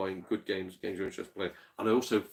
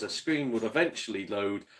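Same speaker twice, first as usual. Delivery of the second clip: ordinary delivery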